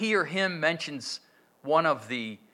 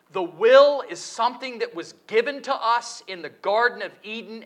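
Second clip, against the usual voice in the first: ordinary delivery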